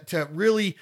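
normal talking voice

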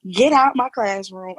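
slow, loud mock yell at a student; half-acted shout of Get out my classroom; retold yell. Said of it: "I start cussing and screaming".

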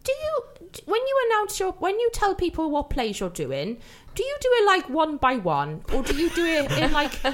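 high-pitched, hammed-up airhead voice; really ditzy caller. Prank caller asks theatres about announcements, put-on ditzy voice. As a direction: play it ditzy and high, groping for the words. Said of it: "do this one as if you're really ditzy".

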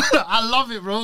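usual speaking voice

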